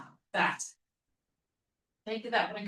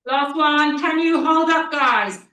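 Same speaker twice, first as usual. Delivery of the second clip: loud, high push for the last rep; loud coaching call; 4 semitones higher, 12 dB louder, slower than usual. Real, not acted. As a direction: drive them to hold on. Coach pushing the final rep.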